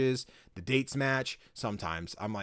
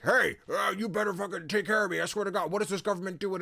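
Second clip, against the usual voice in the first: higher, put-on griping old man; grouchy old man; 8 semitones higher than usual. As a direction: grumble threats like a cranky old man. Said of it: "you get a grouchy old man".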